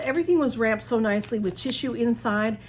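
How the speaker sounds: normal talking voice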